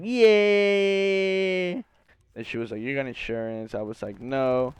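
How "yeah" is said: high, loud drawn-out exclamation; hammed-up voice of her thoughts; imagined inner cry. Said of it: "But in her head".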